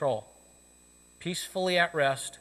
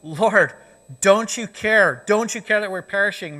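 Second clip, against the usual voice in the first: louder, urgent cry, slowing on the first care; frantic plea; 4 semitones higher, 7 dB louder than usual. Retold or imitated. The disciples waking Jesus in the sinking boat, loud urgent plea. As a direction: shout to wake him up. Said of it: "they're telling him".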